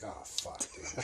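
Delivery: very soft, voiceless swear; hushed dismay. Retold or imitated. Steve reacting to Bill's warrant, near-whispered dismay. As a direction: breathe it out under the voice. Friend's hushed dread, retold.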